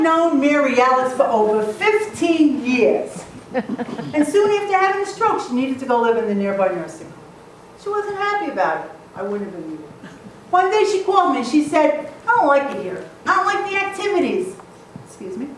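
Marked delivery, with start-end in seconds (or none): none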